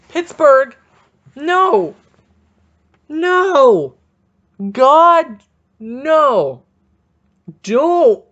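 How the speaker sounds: loud, high-pitched cry of protest; drawn-out exasperated yell; anguished shouting. Acted. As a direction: yell each word out, pleading for it to stop. Yelling plea against a feared trade.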